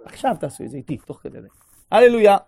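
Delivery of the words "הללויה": exclaimed praise. Acted. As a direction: proclaim it out loud. Guided visualisation, calls out psalm.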